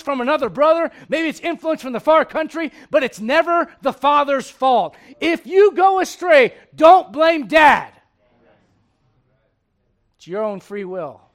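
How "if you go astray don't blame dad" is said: loud and slow, hammer it home; slow loud emphasis. Acted. Preacher to congregation, slow loud warning. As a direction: lean in and lay down the law.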